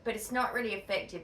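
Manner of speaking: usual speaking voice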